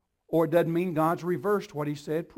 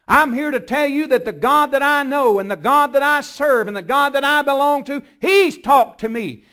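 louder, higher, driving preacher voice; full-force preaching; 7 semitones higher, 12 dB louder than usual. Acted. Pastor urging the church to trust God's word, in a loud, fervent preaching voice. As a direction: preach it hard to the congregation.

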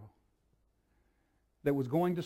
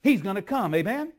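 full-force preaching; 9 semitones higher, 13 dB louder than usual. Acted. Preacher thundering the promise to the congregation, full force. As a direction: preach it out with rising fire.

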